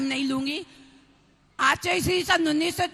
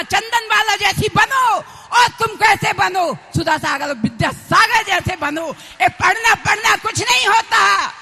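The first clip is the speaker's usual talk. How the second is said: raised, louder exhortation, cried out to the crowd; impassioned preaching; 4 semitones higher, 8 dB louder than usual. Real, not acted. Nun urging devotees to seek self-knowledge over book learning, impassioned preaching. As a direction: preach at full force with ringing phrase ends.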